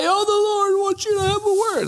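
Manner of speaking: high pleading mother voice, near tears; Mama's plea. Retold or imitated. Mother begging her son to receive a word, high and pleading. Mama would come home and cry.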